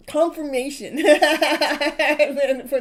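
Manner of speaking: slowed word that breaks into a loud laugh; real laugh spilling into the word; laughing through it. Real, not acted. Laughs mid-word during reading.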